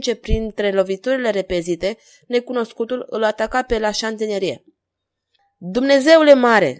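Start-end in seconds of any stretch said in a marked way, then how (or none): 5.6-6.8 loud, high exclamation; alarmed shout. A narrator's reading. King watching his man fall, shouting. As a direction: cry out in alarm. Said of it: "strigă regele".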